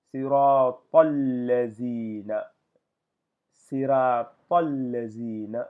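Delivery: Arabic phrase chanted slowly and loudly; careful Quranic recitation, drawn out. Shown as a demonstration. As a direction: recite siratal lazina slowly, linking the words. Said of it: "ici, je vais dire".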